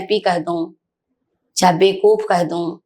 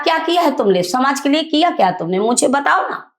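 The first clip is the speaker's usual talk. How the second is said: higher, indignant scolding; retold angry scolding; 6 semitones higher than usual. To the educated officers at the meeting, indignant scolding. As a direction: demand answers from the officers, up high. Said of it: "इतना कुछ कहा".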